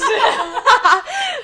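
loud high-pitched laugh; high burst of laughter. Real, not acted. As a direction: crack up laughing.

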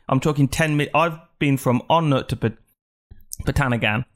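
ordinary talk, nothing marked

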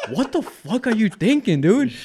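high-pitched mock scolding; imitated incredulous friend. Friend scolds him after the fight, imitated incredulity.

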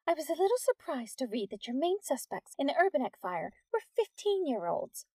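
high, pointed challenge; disapproving. A narrator's reading. Teacher confronting the policeman over his suspects, disapproving.

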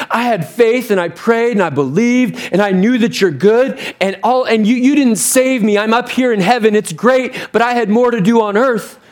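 pleading complaint. Acted. Drowned man protesting to God in heaven, pleading complaint. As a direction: pile up the grievances to God. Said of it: "sounds like our prayers to God".

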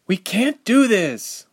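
high, slow, drawn-out protest; defiant. A narrator's reading. Ilse turning on Reshma, defiant. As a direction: dig in and refuse. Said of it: "turning around defiantly".

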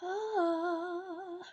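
slow, high announcer intro; show intro voice. Acted. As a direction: welcome listeners like a host.

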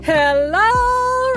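normal talking voice

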